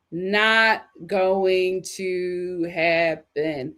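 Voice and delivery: each word drawn out and spaced; very slow emphasis. Shown as a demonstration. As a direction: spell out "Not going to happen" slowly. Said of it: "repeated for emphasis".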